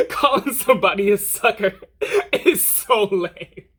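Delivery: real laughter breaking through, high-pitched; trying not to laugh. Real, not acted. Storyteller mocks the word sucker, laughing through the words. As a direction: crack up while talking, voice jumping up. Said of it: "I'm trying not to laugh".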